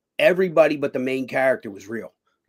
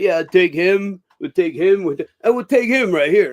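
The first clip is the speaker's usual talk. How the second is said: imitated casting call; 4 semitones higher, 7 dB louder than usual. Casting guy voiced.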